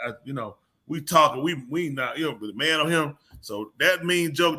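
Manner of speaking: ordinary talk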